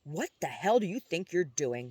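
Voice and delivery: ordinary delivery, nothing marked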